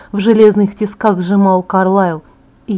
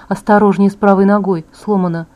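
ordinary delivery, nothing marked

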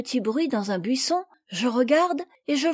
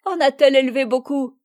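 louder taunting question; mocking; 5 dB louder, faster than usual. A narrator's reading. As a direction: ask it as a jibe. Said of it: "de même". (question moqueuse)

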